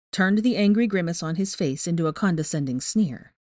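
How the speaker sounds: plain reading voice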